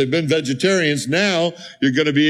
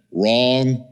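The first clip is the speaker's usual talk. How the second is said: ordinary talk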